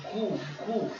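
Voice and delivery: "Gu" with the G made back in the throat, near a K; G close to K. Shown as a demonstration. Teacher explaining Chinese G, "Gu" throaty and almost like "ku". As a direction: push the G of "Gu" back until it nears K. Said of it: "falando ele lá na garganta".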